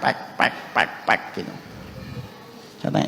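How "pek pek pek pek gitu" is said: soft unvoiced pek pek clapping sounds; hand-clap busker imitation. Preacher mimicking a busker's clapping, soft unvoiced pek sounds. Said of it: "pakai tangan".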